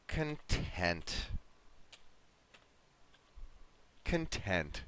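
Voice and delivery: "content" stressed on the second syllable, con-TENT; syllable stress demo. Shown as a demonstration. Teacher shows the word meaning happy, "content" stressed at the end. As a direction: say "content" slowly and low, weak "con", strong "TENT". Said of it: "it's gonna be like this".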